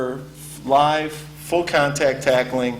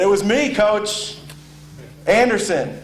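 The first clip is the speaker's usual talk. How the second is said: put-on higher, eager voice of a proud young player; proud freshman; 8 semitones higher than usual. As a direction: answer the coach puffed up. Young player answers the coach.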